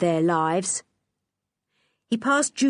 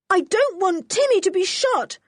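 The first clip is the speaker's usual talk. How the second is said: much higher, louder panicked cry; terrified cry; 13 semitones higher, 4 dB louder than usual. Acted. George crying out for Timmy's safety, terrified. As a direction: fear for the dog. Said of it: "cried out George in terror".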